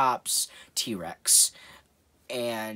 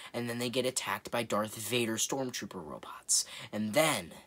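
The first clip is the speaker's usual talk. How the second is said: ordinary delivery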